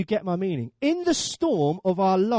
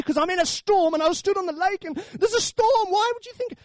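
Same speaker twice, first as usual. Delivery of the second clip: exasperated protest; 10 semitones higher than usual. Acted. Peter protests loudly about the storm.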